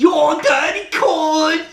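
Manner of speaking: put-on high, loud, drawn-out caricature English accent; hammed-up English insult, shrieked slowly; mock English accent. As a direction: shout it in an over-the-top squeaky English accent. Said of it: "heard his English accent".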